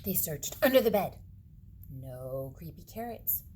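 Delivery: usual speaking voice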